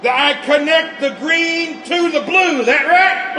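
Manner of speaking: excited shout. Acted. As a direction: holler it up over the noise. Shouting from the bomb bay.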